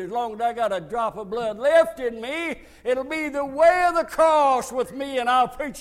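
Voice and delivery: loud, rousing declaration; rally the church like a battle cry; defiant preaching. Real, not acted. Preacher rallying his boys and church, loud and defiant.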